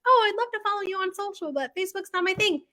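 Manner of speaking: high put-on voice of a viewer who avoids Facebook; customer voice. A viewer on another app answering the bakery: high put-on customer voice. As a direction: play a polite follower turning Facebook down. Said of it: "you're like".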